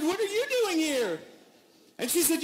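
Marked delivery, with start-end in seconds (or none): 0.0-1.2 even higher, put-on voice throwing the question back; mock startled echo. Passengers echoing the cleaner's question, mock startled. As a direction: parrot her shock right back at her.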